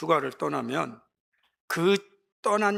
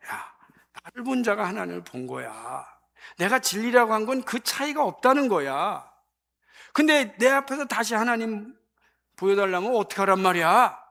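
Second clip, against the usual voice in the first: frustrated Jesus; 6 semitones higher, 5 dB louder than usual. Retold or imitated. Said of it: "이렇게 예수님께서 말씀을 하세요".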